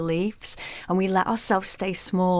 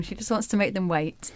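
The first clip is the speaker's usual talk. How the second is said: ordinary talk, nothing marked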